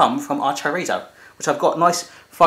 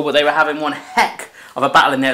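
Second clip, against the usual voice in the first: ordinary delivery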